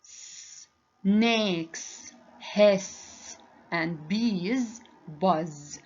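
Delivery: very slow, every sound of "Snakes hiss and bees buzz" drawn out; slow demo. Shown as a demonstration. Teacher models the sounds for pupils, very slowly. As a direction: stretch each word so pupils hear s and z. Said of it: "Can you hear the difference?".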